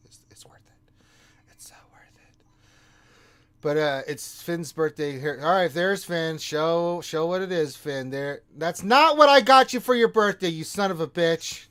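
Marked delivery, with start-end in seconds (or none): 0.1-2.5 hushed whisper of anticipation. Real, not acted. Teasing the birthday reveal. 8.6-11.7 mock outrage. Mock outrage at friend's gift.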